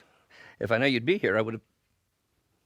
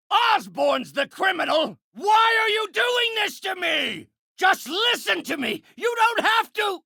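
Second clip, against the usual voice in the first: high, frantic yelling mid-fight; desperate shouting; 14 semitones higher than usual. Acted. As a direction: shout it in outraged protest. Pleading and accusing in a fight.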